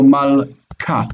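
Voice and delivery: "mal, ka" with a pause before a hard k. Shown as a demonstration. Teacher proving the rule to a student, hard k in "mal, ka". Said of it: "it's hard".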